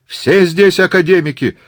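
high, loud retort; heckling shout. Acted. Tourist objects to academician's privilege, heckling. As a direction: yell back from the crowd.

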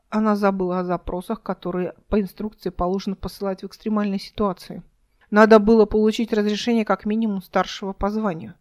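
ordinary delivery

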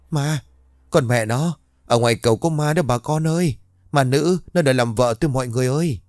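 high, frantic cries for help; panicked shouting. A narrator's reading. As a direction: yell it out in terror. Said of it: "vừa hét ầm cả lên". (la hét hoảng loạn)

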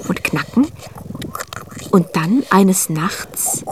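slow suspenseful whisper. A narrator's reading. Storyteller builds suspense for listeners, slow whisper.

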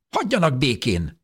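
put-on old woman yelling to be left alone; shouting. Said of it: "kiáltotta".

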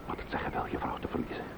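whispered, fast, grief-stricken; the words catch in a throat full of grief; hushed widower's anguish; choked grief. Acted. Widower speaks of losing his wife.

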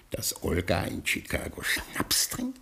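hushed, secret whisper. Acted. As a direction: breathe the question so nobody overhears. Secret worry about wife drinking.

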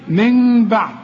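من بعد with a held, unpressed m hum; iqlab. Shown as a demonstration. Teacher warns against clamped lips, من بعد hummed lightly. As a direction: keep lips loose humming before بعد. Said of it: "ما تكزش شفايفك".

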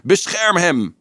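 high, urgent thundered cry; thundering shout. A narrator's reading. Fredo shouting over the children to protect him, thundering cry. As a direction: cry it out over all the din. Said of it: "donderde Fredo's stem die riep".

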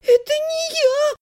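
high, drawn-out whine; whimpering. A narrator's reading. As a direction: whine your denial. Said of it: "заскулила Элиса". (тонко скулить)

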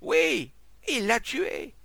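high, loud, alarmed answer; shouted accusation. A narrator's reading. Witness telling the crowd of the killing, alarmed shout. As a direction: cry out the terrible news.

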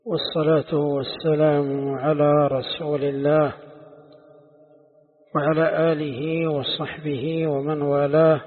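slow, measured sermon opening; unhurried praise formula with drawn-out vowels; formal religious opening. Real, not acted. Teacher opens the lesson with praise of God, slow and formal.

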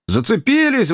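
high, sudden cry; exclaiming. A narrator's reading. Joe tells his companions the anchor has caught, exclaiming. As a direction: call it out high, as sudden news. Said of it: "воскликнул Джо".

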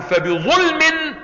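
high, very slow recited word; Quranic recitation. Shown as a demonstration. Teacher quoting the verse to the class, high and slow. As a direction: chant it out like recitation.

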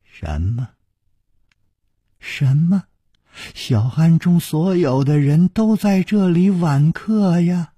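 put-on puzzled, drawn-out, high elderly voice; baffled old nun, stretching each phrase; slow old nun. A narrator's reading. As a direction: answer slowly as if humouring a madman. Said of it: "缓缓地说".

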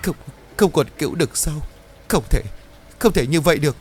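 stammering. A narrator's reading. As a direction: stumble over không in shock. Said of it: "Bà Trang liền lắp bắp". (lắp bắp không tin nổi)

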